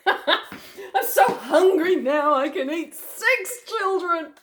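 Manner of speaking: slow, drawn-out hungry laugh; laughing crocodile. Acted. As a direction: chuckle through the greed.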